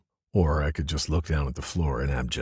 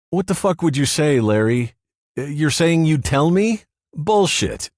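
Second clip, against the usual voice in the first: much higher, loud angry voice; angry confrontation; 12 semitones higher, 8 dB louder than usual. Acted. Paul nose to nose with Larry, angry and loud. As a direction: get in his face.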